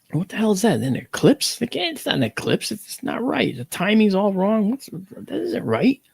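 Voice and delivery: high-pitched, bewildered muttered reasoning; baffled. Retold or imitated. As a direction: puzzle it out in a high, disbelieving voice. His baffled thought at darkening sun.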